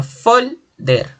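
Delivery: "folder" slowly, high stressed "fol", then "der" apart; slow two-beat "folder", weight on "fol"; stress on penultimate syllable. Shown as a demonstration. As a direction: punch "fol" in "folder", pause, drop to "der". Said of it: "la mayor fuerza de voz en la penúltima".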